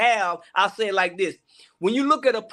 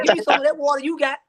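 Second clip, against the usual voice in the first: eager woman at the well; 6 semitones higher, 3 dB louder, faster than usual. Retold or imitated. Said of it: "She said".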